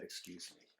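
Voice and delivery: soft polite whisper; gentle whispered manners; whispered excuse me. Shown as a demonstration. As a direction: say it quietly and politely. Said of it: "made a small burp and then said".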